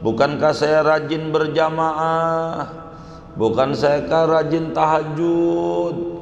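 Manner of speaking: high, sing-song wail of a soul in agony; tormented wailing. Retold or imitated. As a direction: wail for mercy from the grave. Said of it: "saya dengar suara jeritan yang luar biasa".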